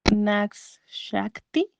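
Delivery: "Nax Shakti" sounded out very slowly, word by word; careful Hindi name. Shown as a demonstration. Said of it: "para compensar mi posible muy mala pronunciación".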